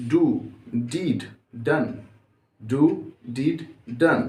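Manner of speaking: slow, deliberate verb forms with a pause after each word; pronunciation drill. Shown as a demonstration. Teacher drills verb forms for students to read aloud. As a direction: read each form clearly as a model to copy. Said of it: "एक बार अच्छे से आप इसका pronunciation, accent देख लीजिए".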